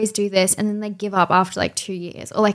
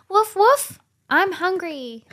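high-pitched dog voice; 9 semitones higher, much slower than usual. Pretend. Friend mocking the squeaky dog voice she dislikes. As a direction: yap it like a tiny silly puppy. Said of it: "not like".

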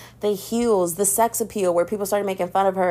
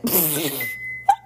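laugh; 3 semitones lower than usual. Real, not acted. Laughing at own rant.

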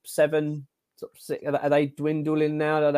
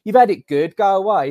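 scornful, louder put-down, higher pitched; dismissive mock pundit; 6 semitones higher, 7 dB louder than usual. Mocking pundits who tell fans to stop moaning, in a louder scornful put-down. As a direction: shoo the fans off like a sneering pundit. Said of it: "it's almost like".

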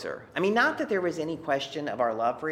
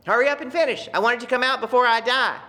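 eager father; 10 semitones higher, 8 dB louder, faster than usual. Retold or imitated. Father urging her to finish.

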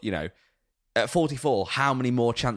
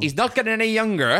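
high, loud Welsh lilt; Welsh accent; 12 semitones higher, 6 dB louder than usual. Retold or imitated. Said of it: "I'm not gonna do the Welsh accent".

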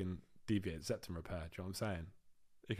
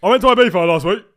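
loud, abrupt over-the-top announcement; much louder mock clumsy announcement; mock blunt podcaster; 5 semitones higher, 20 dB louder than usual. Shown as a demonstration. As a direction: ham up a clumsy topic change. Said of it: "you don't just go like".

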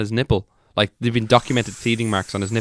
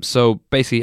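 normal talking voice

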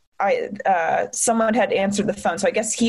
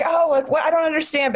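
higher-pitched bewildered protest; feeling attacked; 6 semitones higher than usual. Retold or imitated. Her past self reacting to detective, attacked and bewildered. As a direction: re-enact hurt confusion, pitched up. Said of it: "at the time I felt attacked".

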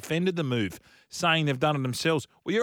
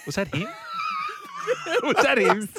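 laughing. Real, not acted. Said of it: "bursts out laughing".

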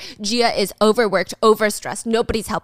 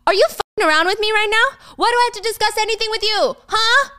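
much higher, louder, fast angry retort; Michael snapping; 11 semitones higher, 3 dB louder, faster than usual. Retold or imitated. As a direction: shout her down, outraged. Michael snaps at wife.

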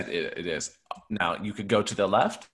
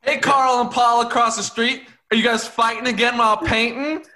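high, raised neighbour's holler; calling across street; 10 semitones higher, 4 dB louder than usual. Acted. Neighbour hailing quarrelling painters across the street, high holler. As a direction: sing out loudly to the neighbours.

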